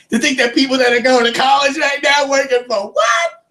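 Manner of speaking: high, strained rising cry; incredulous high-pitched outburst; exasperated rant. Real, not acted. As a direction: build to a pleading yell on the last word. Rant builds up to a shout.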